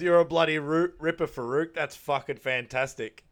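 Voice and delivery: hammed-up delighted Daryl; imitating Daryl. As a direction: cheer him on like a proud dad. Daryl quoted praising Farouk.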